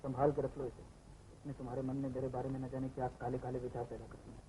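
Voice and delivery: बहुत धीमे, नीचे सुर में, आहत होकर; पास खड़ी लड़की से दबे स्वर में कहो; दबी आवाज़. Acted. Aside to the woman, hurt. (धीमी, आहत आवाज़)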